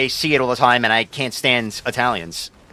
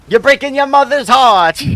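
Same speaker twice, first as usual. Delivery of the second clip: higher and louder, a hammed-up guilt-tripping Italian mom; pitched-up mock mama scolding her son; mock Italian mother; 9 semitones higher, 6 dB louder than usual. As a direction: play a New York Italian mother laying on the guilt.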